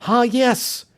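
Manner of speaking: sharp high cry of discovery; cried out. Acted. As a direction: exclaim as the clue appears. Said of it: "he cried".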